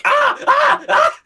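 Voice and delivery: very high, loud screams of panic; high loud panic shrieks; panicked shrieks. Acted. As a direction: shriek like a fish in terror. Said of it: "She freaks the fuck out".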